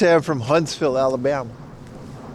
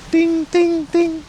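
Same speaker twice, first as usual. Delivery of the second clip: high sung bell ding ding ding; mock bell ding; 13 semitones higher, much slower than usual. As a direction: chime it high like a little bell. Mimicking a bell.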